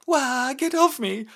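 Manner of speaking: high, slow loud shriek; frightened squeal. Acted. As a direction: push him away, squealing. Daughter fends off the prince.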